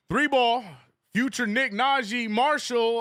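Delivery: excited call. Real, not acted. Live play-by-play call.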